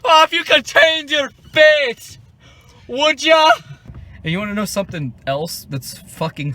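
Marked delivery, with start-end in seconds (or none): 0.0-3.5 much higher and louder, a hammed-up Scottish parody; over-the-top Scottish accent. Parodying the actor's bad Scottish accent, hammed-up Scottish. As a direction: belt it out like a terrible stage Scot. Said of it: "Chris Hemsworth is doing a Scottish accent".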